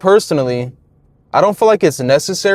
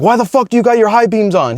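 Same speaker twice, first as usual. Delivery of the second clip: mock cop snapping, pitched higher; cop freaking out; 5 semitones higher than usual. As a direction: play the irritated cop hounding the driver. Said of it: "like, freaking out".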